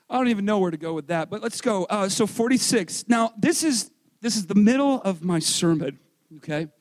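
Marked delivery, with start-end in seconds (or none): none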